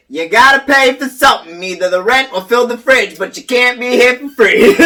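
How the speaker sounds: loud, high mock telling-off; hammed-up scolding of a house ghost; mock scolding yell. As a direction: shout the ghost down like a landlord.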